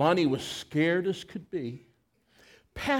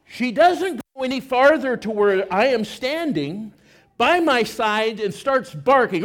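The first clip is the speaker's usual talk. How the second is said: normal talking voice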